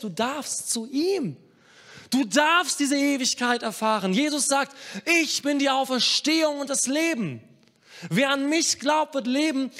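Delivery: high, fervent proclamation; impassioned pulpit voice; full-force preaching. Acted. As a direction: preach it loud and high, stretching the ends of phrases.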